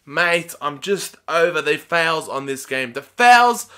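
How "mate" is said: ordinary delivery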